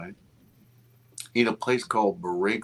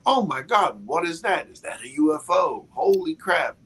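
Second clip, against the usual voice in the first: higher, gasping amazement, one after another; amazed onlookers; 7 semitones higher than usual. Retold or imitated. Onlookers round the pool seeing the UFO, higher amazed exclamations. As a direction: voice the stunned crowd.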